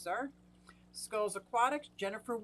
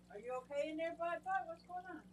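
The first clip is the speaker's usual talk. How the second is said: higher, softer coaxing of a pet fish; soft worried pet talk; 8 semitones higher, 7 dB quieter than usual. Real, not acted. Owner anxiously coaxing her Oscar fish, higher and softer. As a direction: coo anxiously at the fish to check he's alright.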